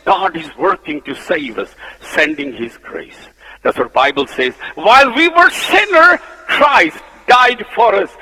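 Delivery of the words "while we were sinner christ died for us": loud, high, ringing proclamation of the verse; proclaimed verse. Shown as a demonstration. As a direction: cry the verse out to the church. Verse proclaimed, then whispered.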